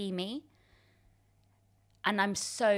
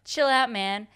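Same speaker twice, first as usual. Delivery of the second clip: louder, higher put-on American accent; 4 semitones higher, 7 dB louder, slower than usual. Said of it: "in an American accent".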